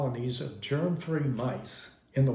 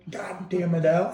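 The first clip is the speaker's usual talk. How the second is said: scolding mom; 5 semitones higher, 3 dB louder than usual. Retold or imitated. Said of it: "That's what she called him".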